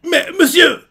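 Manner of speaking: high, startled "Mais monsieur"; a young recruit caught off guard, voice jumping up; shocked protest. Retold or imitated. Retelling a recruit protesting to his commander, high and startled.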